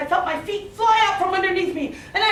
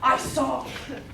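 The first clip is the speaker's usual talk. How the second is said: plain reading voice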